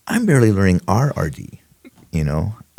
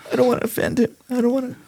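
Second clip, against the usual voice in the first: much higher, fast mock whine; mocking whiny kid; much faster than usual. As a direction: parody a coddled rookie afraid to offend. Mocking coddled young recruits.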